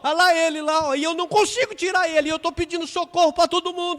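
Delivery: high, very loud pleading cry for help; desperate crying plea. Acted. Boy begs the car owner to save his brother, loud desperate plea. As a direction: beg for help at full voice. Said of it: "Aí o menino chorando".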